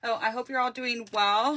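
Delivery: usual speaking voice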